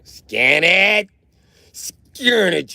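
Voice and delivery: high, loud yell; exasperated yell. Real, not acted. Streamer yelling at a palm scan prompt, exasperated yell. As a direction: shriek it at the machine.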